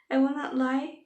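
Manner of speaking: ordinary talk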